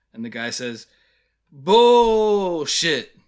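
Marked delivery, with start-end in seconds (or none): 1.5-3.2 loud, high BULL stretched long, then falling; drawn-out mock exclamation. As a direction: imitate a heckler bellowing it slowly. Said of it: "love the way he says the word bullshit".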